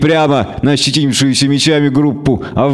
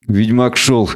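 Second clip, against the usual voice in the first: ordinary delivery, nothing marked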